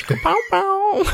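very high drawn-out pow sounds; silly high-pitched sound effect; playful pow noises. Pretend. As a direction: squeal the pows in falsetto. Joking imitation of bouncing off.

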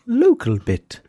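high, loud local twang; local accent. Shown as a demonstration. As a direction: say it the proper local way. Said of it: "They're not the same".

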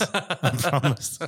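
laughing through the words; breaking into laughter on "I promised". Real, not acted.

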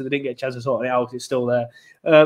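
usual speaking voice

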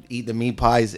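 ordinary talk

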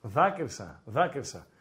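low, slow mock lament; low-pitched, unimpressed "boo-hoo"; mock sympathy. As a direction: feign being moved to tears, dryly. Mock sympathy for co-host's plight.

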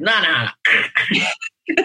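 high, squeaky mock refusal, last no shooting up. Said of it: "she'd be like".

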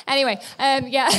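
ordinary delivery

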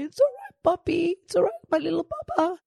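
put-on very high cooing to a crying baby; high soothing baby talk. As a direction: coo it to the baby, high and quick. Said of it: "holding Bonham and going".

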